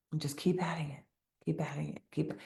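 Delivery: unvoiced whisper, coaxing; whispered urging. Real, not acted. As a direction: breathe the words as gentle encouragement. Coach urging gently, drops to whisper.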